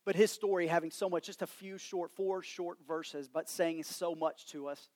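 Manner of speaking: soft, hushed pastor's prayer. Real, not acted. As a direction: pray quietly over the church. Leads congregation in prayer.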